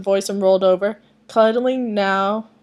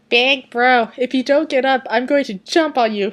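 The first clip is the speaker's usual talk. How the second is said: put-on louder, playful kid threat; mischievous little brother; 5 semitones higher, 6 dB louder than usual. Little brother threatening to jump on his brother, mischievous. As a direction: tease him like a cheeky kid. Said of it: "a hint of mischief in it".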